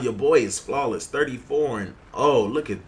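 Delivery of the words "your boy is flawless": normal talking voice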